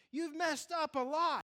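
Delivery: preacher pressing the point to the congregation, high and loud; preaching. Acted. Preaching at full force.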